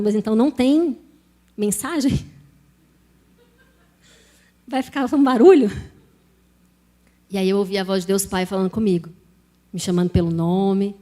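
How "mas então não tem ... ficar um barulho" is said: rising, puzzled question, louder at the end; mock-incredulous questioning; retold bafflement. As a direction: act out baffled protest, building in volume.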